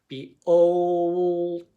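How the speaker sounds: OLT drawn out very slowly, sound by sound. Shown as a demonstration. Teacher showing students the separate sounds, OLT slowly stretched. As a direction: stretch OLT out loud and long, ending on a separate t. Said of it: "tap out each individual sounds".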